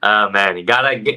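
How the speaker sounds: normal talking voice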